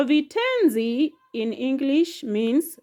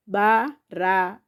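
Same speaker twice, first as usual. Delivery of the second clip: "Barabara" split into ba-ra-ba-ra; syllable by syllable; 2 semitones lower than usual. Shown as a demonstration. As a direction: chop "Barabara" into four short beats. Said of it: "So the syllables are".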